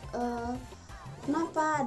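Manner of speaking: ordinary talk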